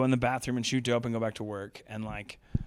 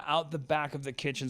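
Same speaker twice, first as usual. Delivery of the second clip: usual speaking voice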